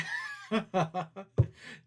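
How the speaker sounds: helpless laugh at the chat; cracking up. Real, not acted.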